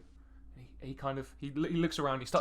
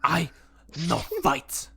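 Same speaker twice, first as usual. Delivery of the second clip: goblin voice; 2 semitones higher, 8 dB louder, slower than usual. Acted. GM voicing goblin.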